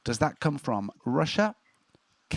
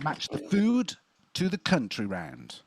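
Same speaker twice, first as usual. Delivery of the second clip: round announcement; 2 semitones higher, slower than usual. Acted.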